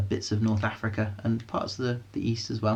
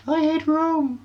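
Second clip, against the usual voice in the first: high squeaky panto whine; broad kids theatre voice; pantomime voice; slower than usual. Pretend. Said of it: "did a pantomime voice".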